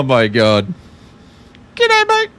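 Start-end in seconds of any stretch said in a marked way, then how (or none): 1.8-2.3 very high, loud squeak of a greeting; squeaky Aussie greeting. Pretend. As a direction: pop it out as a tiny squeak. Squeaky voice joke continues.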